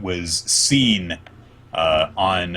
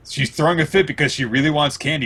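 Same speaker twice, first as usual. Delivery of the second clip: ordinary delivery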